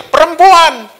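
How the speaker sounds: high, blaming outburst; accusing Adam. Acted. Adam judging his wife before God, high accusing cry. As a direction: throw the blame in a high voice. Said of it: "hakimin istrinya".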